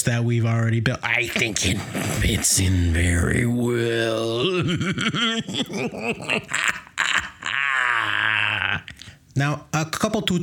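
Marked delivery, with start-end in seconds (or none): 1.0-4.4 slow, creepy demon drawl. Acted. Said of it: "you're a demon". 4.5-9.1 slow, creepy cackle; demon cackle. Acted. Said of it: "just cackling".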